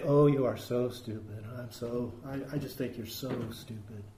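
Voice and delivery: low, very soft, mild reproach; hushed, low voice, harmless-sounding insult; mock-gentle. Shown as a demonstration. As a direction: say the insult softly, without any bite. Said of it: "We don't say it like that".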